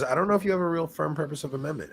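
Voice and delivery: put-on a light Chinese accent for the priest. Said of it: "Remember I tried to do the Chinese accent".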